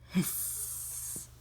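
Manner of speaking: voiceless cat hiss; airy feline hiss. Acted. Cat hisses.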